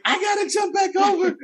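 high whiny protest; teacher dreading the barbed wire fence again, high whiny complaint; exasperated cry. Retold or imitated. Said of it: "And I'm like".

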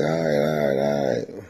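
low reluctant all right; low, weary surrender to the blessing; grudging all right. Retold or imitated. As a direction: give in low and resigned. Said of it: "I'm like".

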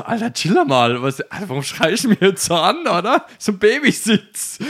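high, loud protest; indignant retort. Retold or imitated. Driver turning round to the shouting officer, indignant. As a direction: push back, exasperated at being yelled at.

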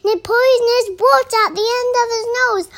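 fast, high and loud; alarmed exclamation. Acted. Mouse describing the creature before it, loud alarmed exclamation. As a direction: rush it out at full voice.

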